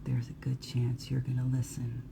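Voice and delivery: soft, confiding near-whisper. Acted. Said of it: "It whispers because it knows".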